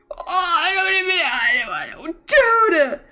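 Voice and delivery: lost for words from joy; real overwhelmed stammer. Real, not acted. Speechless after lucky pulls.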